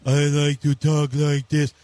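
dad imitating his son's silly voice. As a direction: mimic the kid's stupid put-on voice. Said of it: "he does his voice".

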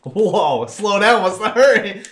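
high, loud shrieking panic; mock panicked screams. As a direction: scream like fleeing creeps. Fleeing men in the game.

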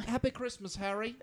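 very high-pitched British greeting; polite British accent. Shown as a demonstration. Wishing Harry happy Christmas, polite high British accent. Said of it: "I try to be polite".

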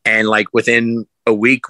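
ordinary talk

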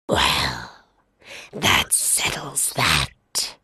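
low, slow, hushed lion; satisfied lion. Acted. Lion settling the matter, low hush. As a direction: purr it with satisfaction.

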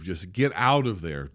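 ordinary talk

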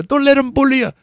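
high, loud imitation of a fight coach; corner man impression. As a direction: holler it like a cornerman firing up his fighter. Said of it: "kept saying that over and over".

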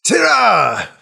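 put-on high, loud, delighted old man; overjoyed to meet an old friend; excited old healer. NPC greets warmly.

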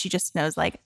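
normal talking voice

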